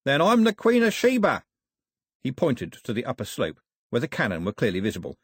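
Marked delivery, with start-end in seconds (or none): 0.1-1.4 high, loud, jovial scoffing; cheerful villain. A narrator's reading. Cox disbelieves Daphne's warning, cheerfully scoffing. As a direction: scoff merrily as the rogue sailor. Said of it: "said Cox cheerfully".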